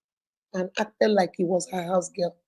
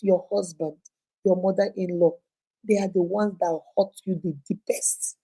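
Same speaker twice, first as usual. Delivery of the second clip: normal talking voice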